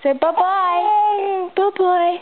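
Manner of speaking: slow, high sing-song goodbye; high baby talk. Acted. Mum waves baby goodbye.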